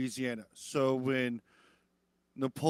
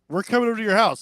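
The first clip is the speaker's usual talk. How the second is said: louder, higher mock eager crowd; eager coworkers; 7 semitones higher, 11 dB louder, much faster than usual. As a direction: play the pushy coworkers inviting themselves.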